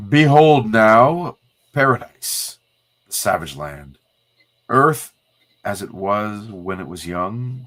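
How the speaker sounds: slow, grand announcer narration; dramatic narration. A narrator's reading. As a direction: unveil it like an epic trailer. Reading comic captions aloud.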